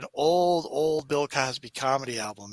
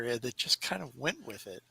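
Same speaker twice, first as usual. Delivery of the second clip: normal talking voice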